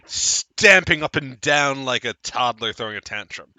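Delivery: ordinary talk, nothing marked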